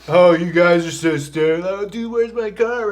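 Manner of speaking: hammy dad-joke voice, high; mock dad voice. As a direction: tease like a corny dad. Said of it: "that's like the dad joke of".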